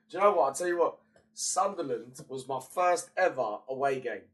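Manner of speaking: usual speaking voice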